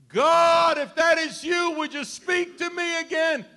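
high shouted call upward; loud plea. Retold or imitated. Man on the cliff shouts up to God for reassurance, high and loud. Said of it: "man calls again to God".

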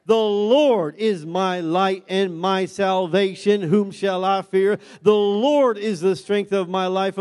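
loud, declaimed scripture and exhortation; full-force preaching. Real, not acted. Preacher declaring the Lord is my light, fervent preaching. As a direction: proclaim it to the congregation.

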